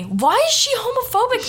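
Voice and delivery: high-pitched disbelieving question; incredulous outburst. Real, not acted. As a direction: break off reading, baffled and high. Interrupts own reading in disbelief.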